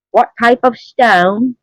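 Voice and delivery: slow, high mock Australian voice; a lightly done Australian accent; attempted Australian accent. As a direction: play at an Australian accent, slowly. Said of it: "I'm just getting Australian down".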